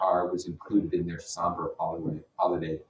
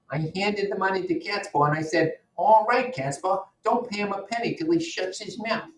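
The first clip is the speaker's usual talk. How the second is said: higher character voice for Wolfsheim, loud on "All right"; boastful gangster telling a story, pitched up; 13 semitones higher, 5 dB louder than usual. Acted. Teacher voices Wolfsheim in reading.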